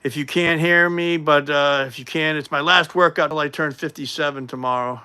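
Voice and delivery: loud, projected announcement. Real, not acted. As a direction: project so they can hear you.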